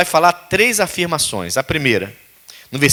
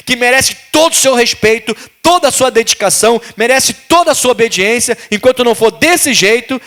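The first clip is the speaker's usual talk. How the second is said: raised, insistent preacher voice, pleading with the flock; fervent preaching; 5 semitones higher than usual. Acted. Pastor urging the congregation to surrender, fervent preaching. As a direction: drive it home like a pastor at full force.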